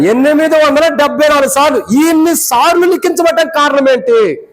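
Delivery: high-pitched, insistent preaching. Acted. (ఎత్తైన స్వరంతో ప్రసంగం)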